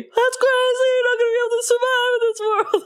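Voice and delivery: very high, singsong comedian impression; exaggerated high-pitched animated-bee comedian voice; Jerry Seinfeld. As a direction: whine it out high and fast, hamming it up. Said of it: "That sounded like Jerry, uh, Seinfeld".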